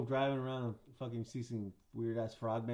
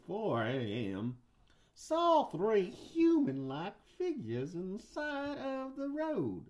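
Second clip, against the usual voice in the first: slow, high-pitched Southern drawl; Southern guy; 4 semitones higher, slower than usual. Pretend. Host narrates Loveland Frogs legend, slow Southern accent. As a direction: stretch it out like a Southern storyteller. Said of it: "I'll do a Southern guy".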